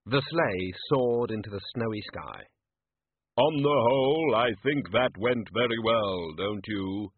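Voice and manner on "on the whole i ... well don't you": Death voice. Acted.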